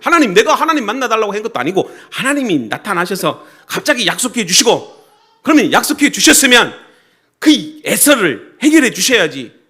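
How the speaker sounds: loud, high-pitched acted protest to God; indignant protest. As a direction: argue with God, slowing hard on the final demand. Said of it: "이렇게 지금 항변하는 거예요". (큰 소리로 하나님께 따지는 항변)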